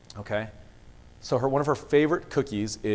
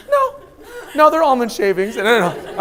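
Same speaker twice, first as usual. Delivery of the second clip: higher, louder protest; loud objecting; 13 semitones higher, 11 dB louder than usual. Retold or imitated. Dad correcting daughter, loud laughing protest. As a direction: insist it's almonds, loud.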